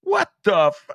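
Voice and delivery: high-pitched retold disbelief; incredulous reaction. Host reacting to the video, incredulous reaction. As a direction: blurt it high, stunned and about to laugh.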